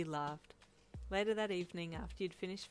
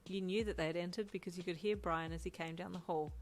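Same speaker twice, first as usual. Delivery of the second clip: ordinary delivery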